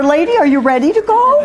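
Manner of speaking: high, sweetly deferential question; polite coaxing. Acted. Maureen now asking whether Lady is ready, politely. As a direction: lilt upward as if asking permission. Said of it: "the conversation between them began to change".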